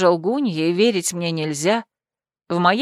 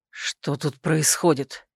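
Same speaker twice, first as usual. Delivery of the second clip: softer, slow stunned breath; 5 semitones lower, 6 dB quieter, much slower than usual. A narrator's reading. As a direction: exhale the question in shock. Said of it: "выдохнул он".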